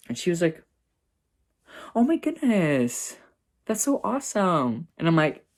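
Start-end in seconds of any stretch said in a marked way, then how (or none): 1.9-4.9 high, drawn-out imitation of a thrilled sister; delighted gushing. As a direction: gush it high, stretching the words.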